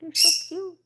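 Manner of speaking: very high, soft coaxing call; pet baby talk. Acted. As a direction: call the chick over sweetly. Cooing at baby birds.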